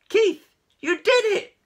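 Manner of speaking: high, loud squeals of delight; cheering. A narrator's reading. Cats celebrating Keith's magic, high loud cheer. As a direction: cheer him wildly. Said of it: "the other cats cheered".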